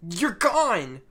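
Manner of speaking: mildly frightened cry of disbelief; nervous. Retold or imitated. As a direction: blurt it out, rattled and startled. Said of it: "very nervously says".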